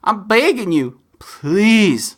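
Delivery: heartfelt begging plea; pleading. Real, not acted. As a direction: beg the audience sincerely. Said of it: "I beg you".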